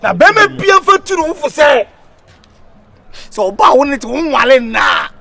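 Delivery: loud, high ranting; shouting. Acted. Hype man ranting loudly to the crowd. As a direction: rant loudly.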